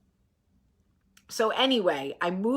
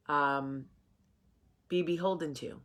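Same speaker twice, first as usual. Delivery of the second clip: ordinary talk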